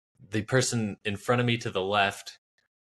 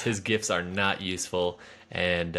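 ordinary talk

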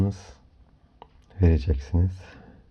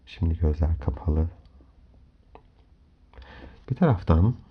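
soft spa whisper; 3 semitones lower, 4 dB quieter than usual. Acted. Leads a guided relaxation. (yumuşak rehberli meditasyon sesi)

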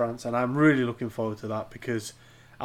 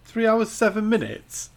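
high, slow disbelief; can't believe what he's hearing; incredulous; 9 semitones higher, 6 dB louder, slower than usual. Real, not acted. Incredulous at four-hour claim.

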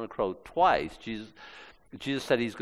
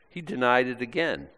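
normal talking voice

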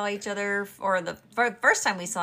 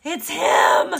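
high, loud shout of recognition; excited shout; 8 semitones higher, 12 dB louder than usual. Retold or imitated. Wife retelling spotting her crush, excited shout. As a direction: shout it out, thrilled to spot him.

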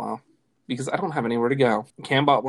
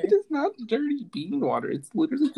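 indignant; 11 semitones higher than usual. Real, not acted. Indignant protest.

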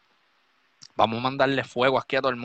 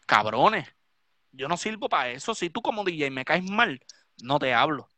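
usual speaking voice

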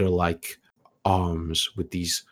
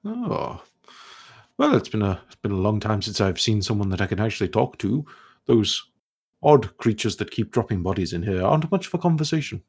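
scholarly elf ghost; 2 semitones higher than usual. Acted. Ghost speaks.

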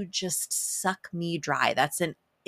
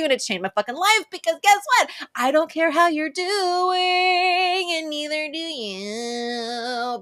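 1 triumphant sass; 9 semitones higher, 4 dB louder than usual. Acted. Triumphant punchline at the end.

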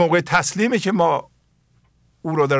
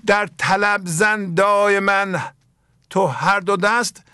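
slow, raised, chanted verse reading; measured Persian verse recitation, lingering on each word; poem recitation; 4 semitones higher, slower than usual. Acted. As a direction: intone the couplet slowly like classical poetry. Rereading the same verse.

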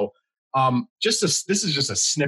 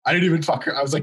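ordinary talk

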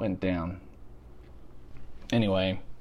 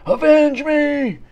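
slow, much higher, louder dying cry; overacted death scene; 8 dB louder, much slower than usual. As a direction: wail it like a hammy movie death. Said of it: "like in fucking Red Dawn".

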